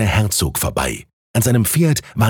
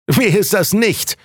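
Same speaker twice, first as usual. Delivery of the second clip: higher, slow drawn-out retort; heckler's retort; 10 semitones higher than usual. A narrator's reading. Onlooker answers the would-be brother, high drawn-out retort. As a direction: toss it back from the crowd, pitched up and stretched. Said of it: "antwortete jemand".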